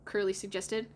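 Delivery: ordinary delivery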